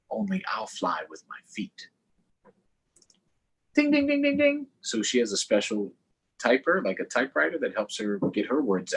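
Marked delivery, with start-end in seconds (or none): 0.1-1.9 whispered dreamy wish, higher. A narrator's reading. Dreamy close to flying image. 3.7-4.7 high sung-out ding like a bell. Pretend. Imitating the brailler bell.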